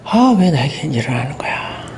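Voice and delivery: whispered, bewildered lament; a hurt worker whispering to himself; despairing whisper. Retold or imitated. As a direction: breathe it out as if to yourself in disbelief. Acting out despair, denial.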